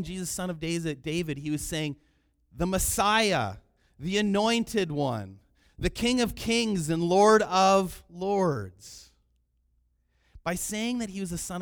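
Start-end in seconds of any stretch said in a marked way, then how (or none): 2.5-9.1 loud, slow, ringing titles; emphatic proclamation. Acted. Preacher declares what the blind man meant, emphatic proclamation. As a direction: proclaim each title slowly and forcefully.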